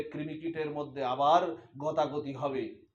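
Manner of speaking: ordinary talk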